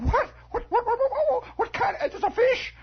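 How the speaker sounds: very high, squeaky disbelief; acted boy, flabbergasted; stammering astonishment. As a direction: sputter it out in a high voice. Boy stunned to learn it's a fish.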